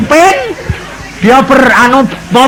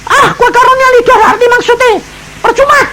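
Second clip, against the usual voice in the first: much higher, scornful teasing voice; mocking; faster than usual. [name] mocking his wife's tongues, high and scornful. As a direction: sneer at the wife in a high mock voice. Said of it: "ngejek istri nih".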